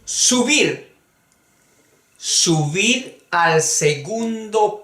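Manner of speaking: frases leídas despacio y claras para repetir; léelo como modelo para la clase; lectura didáctica lenta. Shown as a demonstration. Lee frases de lección despacio. (lectura lenta de profesor)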